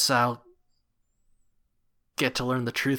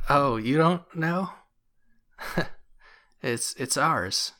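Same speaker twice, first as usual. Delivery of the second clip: chuckling; 3 semitones higher, slower than usual. Acted. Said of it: "they chuckle and they say".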